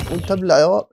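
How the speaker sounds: loud, high jeering shout; taunting yell. Real, not acted. Gamer taunting a zombie as he kills it, shouting. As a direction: jeer while striking. Taunting mob while hitting.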